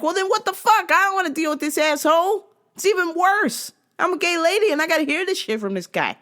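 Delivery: high-pitched, fast frustrated rant; high, fast rant at the fleeing boss; exasperated rant. Retold or imitated. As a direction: vent it, pitch up, speeding on.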